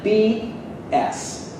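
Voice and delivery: usual speaking voice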